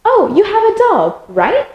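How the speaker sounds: bright, high "Oh, you have a dog" then rising "right?"; tag question with right. Shown as a demonstration. Teacher modelling a tag question for learners, rising "right?". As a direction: make "right?" climb up like a real question. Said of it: "you can always just use right".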